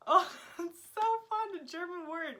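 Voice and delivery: loud, high, gushing delight; delighted excitement. Real, not acted. Vlogger finds a fun German word, excited gushing. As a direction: gush over it, pitch way up.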